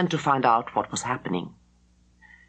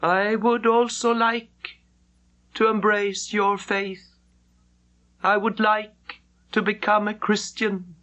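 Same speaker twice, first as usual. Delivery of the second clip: slow, higher and solemn; grave Mandarin; 5 semitones higher than usual. Acted. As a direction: speak each word gravely, as a confession of faith. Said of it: "he turned very gravely towards her".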